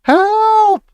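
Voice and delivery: very high, sustained cry; scream for help. A narrator's reading. Terrified mother calls for rescue from mouse, high scream. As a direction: squeal for help in panic. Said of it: "screamed Missus Jenkins".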